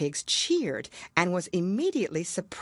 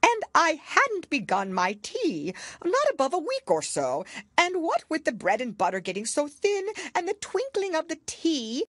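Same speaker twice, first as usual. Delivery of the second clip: nervous Hatter; 12 semitones higher than usual. A narrator's reading. Hatter pleading with the king, higher and nervous. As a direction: babble nervously before the king. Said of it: "in a trembling voice".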